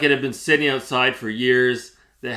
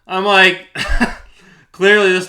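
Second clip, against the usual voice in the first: laughing burst, higher and louder; 7 semitones higher, 9 dB louder than usual. Real, not acted. Telling viewers about the seller, laughing. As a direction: laugh in disbelief.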